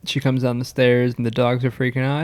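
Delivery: ordinary talk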